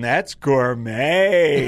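slow, low, drawn-out gourmet; a hammed-up connoisseur voice; mock catchphrase. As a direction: savour each word like a food critic. Imitates their catchphrase.

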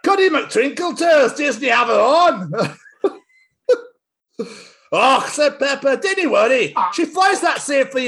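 put-on high Scots kids' voices, slowing, stifling laughs; Scottish Peppa characters. Reading Peppa book aloud, Scottish child voices. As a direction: play the wee girls in broad Scots. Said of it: "I'm gonna do it in my accent".

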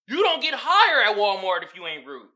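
ordinary delivery

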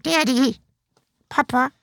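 pleading little dog voice calling Papa. Pretend. Podcast host voicing his dog calling Papa. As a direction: voice his dog begging for attention.

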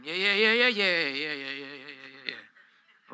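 rapid run of yeahs trailing to nothing; mock string of yeahs, fading out; fading "yeah" chant. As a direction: rattle off yeahs, sinking and dying away. Mimes a long reluctant response.

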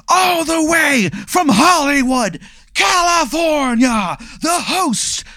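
slow, high, drawn-out ring intro; ring announcer. Retold or imitated. Announcer introducing the host to the crowd, in a slow high boom. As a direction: stretch every word like a boxing announcer.

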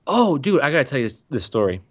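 ordinary talk, nothing marked

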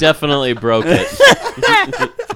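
slow, laughing through it; chuckling as you say it. Real, not acted. Laughing agreement.

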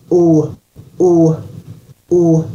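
"or" as one pure rounded vowel, no R; "or" sound. Shown as a demonstration. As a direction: hold "or" as an unglided Scottish O. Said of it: "If you make the or sound".

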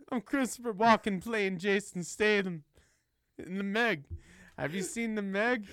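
jokey celebrity impression with an odd, broken-up cadence; Christopher Walken. Co-host as Walken playing an action star, Walken impression. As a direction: play the quirky actor playing someone else. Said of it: "Why did my voice go to Christopher Walken?".

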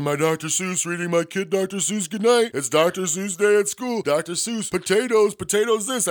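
high, loud mocking voice of a worked-up internet fan; mocking internet idiot. Podcaster mocking outraged internet idiots, high squealing parody voice. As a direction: sneer at them by squealing their posts in a high put-on voice. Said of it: "all the internet idiots buy in and get all mad".

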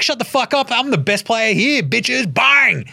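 swagger and shout down the others; cocky young star. Retold or imitated. Sharpe telling teammates he's best: high brash boast. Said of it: "Shaedon Sharpe is like".

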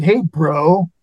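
put-on bro voice, not his own; mock the guy back with his own bro lingo; bro talk. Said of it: "I don't even talk like that".

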